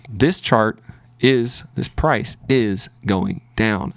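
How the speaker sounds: slow, deliberate inner conviction. Retold or imitated. Trader voicing his gut about the chart, slow and deliberate. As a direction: spell it out slowly like a certain gut feeling. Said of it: "my mind is saying".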